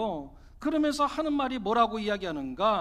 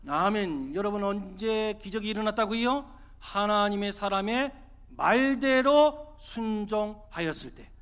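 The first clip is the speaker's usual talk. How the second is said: usual speaking voice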